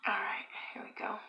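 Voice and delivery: soft unvoiced whisper. Real, not acted. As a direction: breathe the words out quietly. Quiet mukbang, whispered start.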